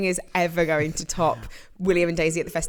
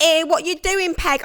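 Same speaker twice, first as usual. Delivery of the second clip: lightly voiced Cockney soap character, higher; a hint of a London soap gossip; EastEnders voice; 10 semitones higher than usual. Imagining an EastEnders scene for the co-host, lightly put-on Cockney. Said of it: "something they'd say in EastEnders".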